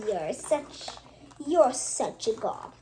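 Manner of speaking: usual speaking voice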